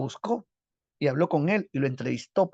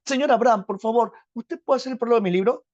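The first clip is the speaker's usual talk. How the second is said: put-on high, louder pleading young Vallejo; eager plea; 8 semitones higher, 6 dB louder than usual. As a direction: beg for the prologue, pitched up. Vallejo pide el prólogo. (súplica ansiosa, aguda y fuerte)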